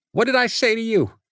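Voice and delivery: sharp, high and fast scolding; angry warning to a friend; stern rebuke. Acted. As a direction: cut him off hard. Adam scolding Nate.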